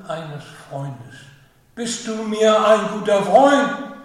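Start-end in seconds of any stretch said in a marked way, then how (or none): 1.7-4.0 loud. Acted. The Lord asks Peter.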